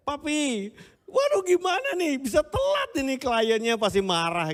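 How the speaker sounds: high, frantic daughter's voice; a daughter in a panic calling her dad; panicked daughter on phone. Retold or imitated. Daughter phones dad stuck in traffic, high and frantic. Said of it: "Langsung dia telepon saya".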